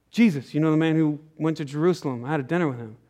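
low, soft and simple reply; quiet Zacchaeus. Retold or imitated. Zacchaeus telling the shocked townsman, low and soft. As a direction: answer gently, almost humbly.

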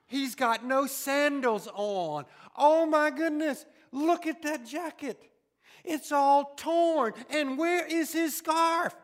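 loud scolding outburst, slow and stern; a loud, exasperated dad; angry father. Acted. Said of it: "We see God as this angry father".

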